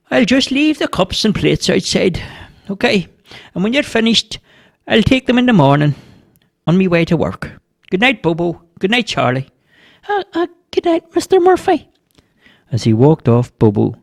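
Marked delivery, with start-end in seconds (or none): 0.1-9.5 higher-pitched kindly neighbour's voice. A narrator's reading. Neighbour leaving tea things for the two on guard, kindly. 10.1-11.9 put-on very high, hesitant squeaky boy; little boy. A narrator's reading. Boy saying good night to a neighbour, hesitant.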